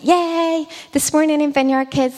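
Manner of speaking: high, cheerful cheer opening the news; excited announcement. Retold or imitated. Kids' leader messaging news of new followers, excited. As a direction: read out good news with a high, happy lift.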